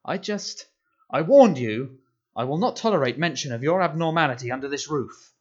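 raging uncle. A narrator's reading. Uncle raging at nephew about abnormality, very loud. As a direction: rage over his own words.

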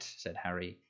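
ordinary delivery

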